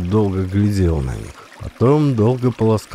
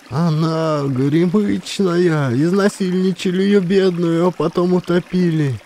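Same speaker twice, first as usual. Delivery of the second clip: lamenting; 8 semitones higher than usual. A narrator's reading. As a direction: mourn the poor girl aloud. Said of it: "Баба закачала головой". (баба причитает, жалостливо и протяжно)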